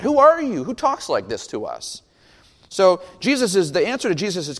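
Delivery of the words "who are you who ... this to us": affronted disbelief. Retold or imitated. Pharisees answering John's rebuke, affronted disbelief. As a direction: act out taken-aback indignation. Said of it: "they didn't know what to do".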